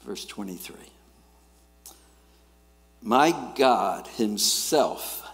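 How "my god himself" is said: very slow and louder, weighing each word; solemn scripture reading. Real, not acted. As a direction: read the verse with reverence. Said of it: "reading scripture aloud".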